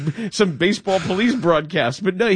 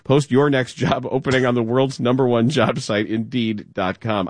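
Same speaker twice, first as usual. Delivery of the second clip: lower, smooth sponsor read; 6 semitones lower than usual. Acted. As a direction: read it like a radio ad. Reads a sponsor ad.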